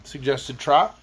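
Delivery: ordinary delivery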